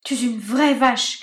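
slow, loud insult; crying insult. Acted. Marinette insulting Benjamin, slow loud cry. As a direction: spit the insult out slowly. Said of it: "cria-t-elle".

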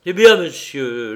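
loud, slow opening burst; forceful captain's outburst; emphatic exclamation. A narrator's reading. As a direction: cry out, leaning hard on the first words. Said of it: "il s'écria".

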